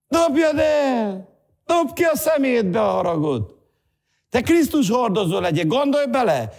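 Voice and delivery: high, urgent pulpit exhortation; fervent challenge, pitched well up; impassioned preaching. Real, not acted. Preaches at full force.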